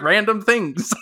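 normal talking voice

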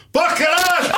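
put-on high little child's outburst, laughing; toddler shouting swear. Daughter yells in restaurant, father mimics, high child voice. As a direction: shout it like a cheeky small girl. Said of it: "de repente vira-se assim".

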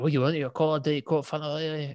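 lightly imitated thick Scottish service-station accent. Service-station worker asking mum's drink order, in a mock thick Scottish accent. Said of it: "it was just such a, such a thick accent".

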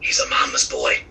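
hushed, voiceless whisper of a retold aside; imitated whisper. Retelling a friend's remark in the theater, whispered. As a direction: whisper it like a friend leaning over. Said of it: "friend leaned over and whispered".

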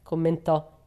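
ordinary delivery, nothing marked

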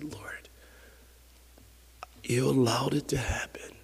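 almost whisper it in surrender; hushed prayer. Acted. Believer asking the Lord amid trouble, hushed surrender.

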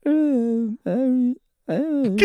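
high wordless mock groans; imitated sedated moaning.

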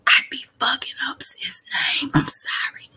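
sheepish whisper under the breath; embarrassed whispered apology; whispered aside. Real, not acted. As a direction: drop to a whisper to apologize for the name. Aside after fumbling a name.

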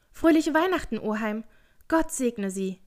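high, loud merry greeting; cheerful call. A narrator's reading. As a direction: call out brightly in high spirits. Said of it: "rief eine muntere Stimme".

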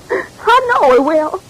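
tearful. Acted. Daughter insists her fiancé will return.

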